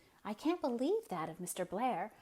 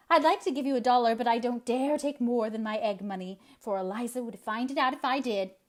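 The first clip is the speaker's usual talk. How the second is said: out of breath; 4 semitones higher, 6 dB louder than usual. Acted. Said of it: "so out of breath that she could hardly speak".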